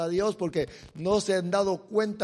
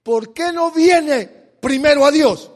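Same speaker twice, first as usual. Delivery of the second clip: louder, higher rhetorical question; loud challenge; 7 semitones higher, 12 dB louder than usual. Real, not acted. Preacher challenging the congregation, loud and high. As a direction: demand an answer from the people. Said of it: "con ustedes y los que nos escuchan".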